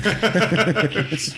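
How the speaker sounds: high bursting laugh; burst of laughter. Real, not acted. Player laughing at the GM's pop-up joke, bursting laugh.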